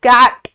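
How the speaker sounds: कैट joined as one loud syllable; blended word. Shown as a demonstration. Teacher joining the letter sounds, कैट said loudly as one blended word. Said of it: "जोड़ के alphabets को पढ़".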